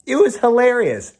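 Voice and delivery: high, loud burst of delight; excited. Real, not acted. Laughing at own cat costume.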